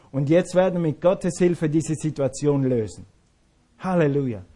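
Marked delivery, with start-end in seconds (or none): none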